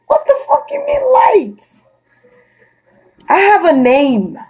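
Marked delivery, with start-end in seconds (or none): 0.1-1.6 high-pitched indignant snap; high, incredulous outrage; outraged disbelief. Real, not acted. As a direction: throw it back in disbelief. Outraged at being called light.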